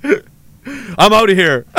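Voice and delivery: usual speaking voice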